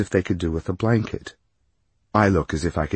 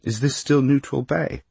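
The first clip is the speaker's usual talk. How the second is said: higher, brightly put question; brightened up; 7 semitones higher than usual. A narrator's reading. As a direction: ask it brightly, trying to sound interested. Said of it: "I added more brightly".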